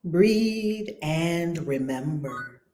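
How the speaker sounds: hushed prayerful chant of peace; guided prayer. Acted. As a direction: lead them into calm, slowly with long pauses. Leads prayer meditation.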